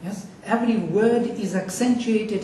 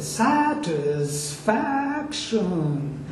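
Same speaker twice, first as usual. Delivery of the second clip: SATISFACTION stretched slowly, FAC raised after a dip; exaggerated word accent; much slower than usual. Shown as a demonstration. Teacher exaggerating satisfaction to show accent, exaggerated word accent.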